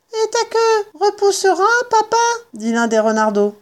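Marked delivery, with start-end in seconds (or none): 0.1-2.2 put-on high-pitched child fox voice; fox cub. Fox cub asks father about his tail, high child voice. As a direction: ask as a little one, much higher.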